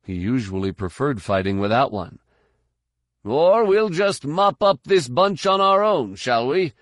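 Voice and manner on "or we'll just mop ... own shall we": loud, high, sarcastic holler; raised voice. A narrator's reading. Fighter needling his busy leader mid-battle, yelling. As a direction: yell the jibe across the fight. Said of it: "didn't need to raise his voice".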